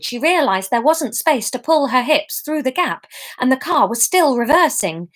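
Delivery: usual speaking voice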